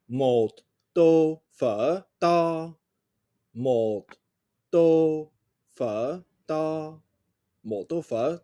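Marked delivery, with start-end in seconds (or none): 0.9-2.7 to, fa, ta said as a listening drill; teacher saying to, fa, ta slowly for listening; tones. Shown as a demonstration. Teacher reads syllables aloud to class, clear drill voice. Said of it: "Quel mot entendez-vous?". 4.7-7.0 to, fa, ta repeated as a drill; tones. Shown as a demonstration. Teacher repeats syllables for class, clear drill voice. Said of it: "Quel mot entendez-vous?".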